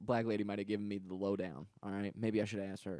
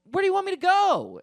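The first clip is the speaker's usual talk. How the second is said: high, loud retold protest; acted-out driver yelling in protest; exasperated yell back; 10 dB louder than usual.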